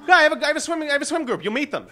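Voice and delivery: high, loud eager pitch; eager David. Retold or imitated. David pitching his swim group, high and loud. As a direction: sell it with a pushy beam.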